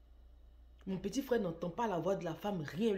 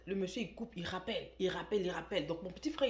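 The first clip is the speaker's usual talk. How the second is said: normal talking voice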